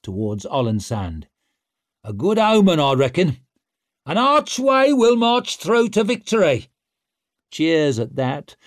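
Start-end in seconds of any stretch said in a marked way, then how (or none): 2.0-6.7 loud, high, triumphant boast; boastful shout. Acted. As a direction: crow it to the crowd.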